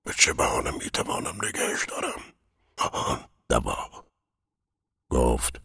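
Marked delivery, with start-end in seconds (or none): 0.0-4.1 whispered anxious thought; inner voice. A narrator's reading. Sick man afraid to be left alone, whispered to himself. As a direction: search for an excuse in a whisper. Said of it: "با خود گفت".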